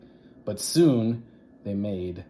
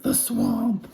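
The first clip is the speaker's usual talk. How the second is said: much lower, loud horrified moan; dread-filled groan; 14 semitones lower, 4 dB louder than usual.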